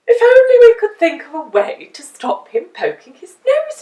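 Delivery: high, loud exasperated wish; Mister Brush. A narrator's reading. The painter wishing aloud at the meeting, high and loud. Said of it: "said Mister Brush".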